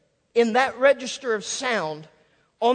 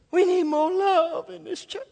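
plaintive churchgoer; 9 semitones higher, 3 dB louder than usual. Acted. Member walking into church longing for love, high plaintive appeal. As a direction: put on a pleading, pitched-up voice. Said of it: "Walk into church and say".

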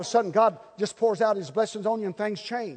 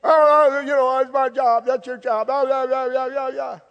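mocking wife; 4 semitones higher than usual. Mocking wife's nagging voice.

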